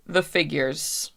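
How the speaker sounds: ordinary talk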